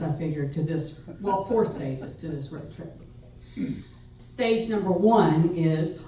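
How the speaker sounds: usual speaking voice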